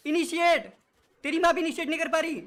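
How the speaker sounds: furious high yell; angry yelling. Real, not acted. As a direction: rage at the teammate. Raging at teammate's play.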